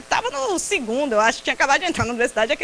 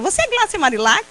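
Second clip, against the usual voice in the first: higher-pitched, rising question; eager inquiry; 7 semitones higher, 3 dB louder than usual. Retold or imitated. Someone at Cabugi asking a visitor her name, eager. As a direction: ask with keen, high-pitched interest.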